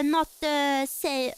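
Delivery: ordinary talk, nothing marked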